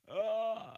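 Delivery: high, loud "ah" outburst; loud cry. Pretend. Crying out amid a mock gun debate, high yell. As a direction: let out a sudden high-pitched yell.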